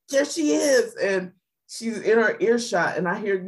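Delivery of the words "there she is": high, eager little cry; excited child. Retold or imitated. Girl in the tunnel spotting her idol, thrilled. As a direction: spot your hero and gasp it out. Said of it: "you can hear her say".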